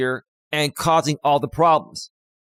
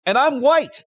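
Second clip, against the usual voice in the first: indignant emphasis; 10 semitones higher, 3 dB louder than usual. Real, not acted. Pointing out his own race.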